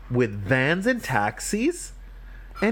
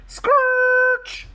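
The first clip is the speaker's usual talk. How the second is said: very high, loud screech; brake screech; 6 dB louder than usual. Acted. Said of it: "Went the brakes".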